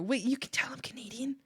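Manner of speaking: breathless startled whisper; whispered disbelief. Real, not acted. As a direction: hush it as if caught out. Startled that chat noticed accent.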